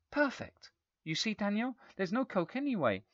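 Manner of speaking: high, bright-toned relief; relieved mother. A narrator's reading. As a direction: sing it out to the child, relieved.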